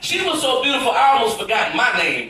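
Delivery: usual speaking voice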